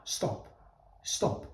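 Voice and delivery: ordinary talk, nothing marked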